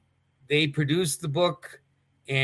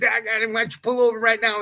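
quick, higher imitation of Wahoo; Wahoo's voice; 7 semitones higher, 3 dB louder, faster than usual. Urging to pull over, imitating Wahoo's higher voice, fast. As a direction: rattle it off high, imitating Wahoo. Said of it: "Wahoo used to talk like this".

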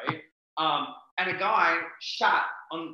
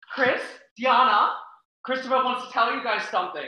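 announcing nan; 9 semitones higher, 9 dB louder than usual. Retold or imitated. Nan summoning mom and dad for grandson's news, announcing. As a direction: imitate a grandmother calling the parents to attention.

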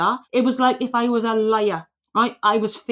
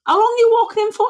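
much higher, louder, fast vet question; alarmed vet; 12 semitones higher, 5 dB louder, faster than usual. Retold or imitated. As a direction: imitate the vet's shocked demand. Teller voices the stern vet.

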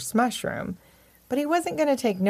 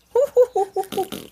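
high hooting laugh; giggly "hoo-hoo" chuckle; 5 dB louder than usual. A narrator's reading. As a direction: hoot with laughter, high and loud. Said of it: "he laughed and tooted and smiled".

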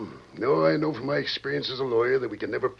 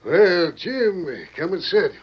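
put-on loud, high, booming greeting to an old friend; overly jovial banker welcoming a mark; hearty welcome; 8 semitones higher, 7 dB louder, much slower than usual. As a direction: throw the door open with your voice.

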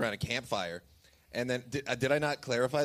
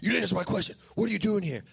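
lightly put-on Mexican accent, raised pitch; terrible Mexican accent; 5 semitones higher, faster than usual. Suspicious man questioning an intruder, bad Mexican accent. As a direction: question him in a rough imitation Mexican accent. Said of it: "That's a terrible Mexican accent".

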